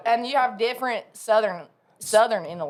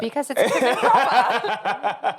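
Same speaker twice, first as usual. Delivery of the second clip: put-on slow, dainty British accent with a laugh; prim British accent; 5 semitones higher, 3 dB louder, slower than usual. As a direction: play the proper English lady, laughing. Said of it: "why are you whispering".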